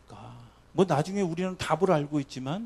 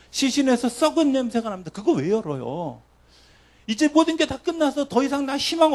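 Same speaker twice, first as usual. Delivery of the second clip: high, loud despairing plea; Martha's protest; 9 semitones higher, 7 dB louder than usual. Retold or imitated. Martha protesting to Jesus, high and loud with despair. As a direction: throw the grief back at Jesus.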